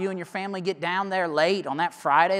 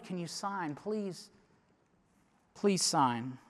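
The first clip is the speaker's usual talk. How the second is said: much softer, pleading; doctor softly begs the father to sign the form; hushed plea; 10 dB quieter, much slower than usual. Retold or imitated. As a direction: beg him quietly to sign.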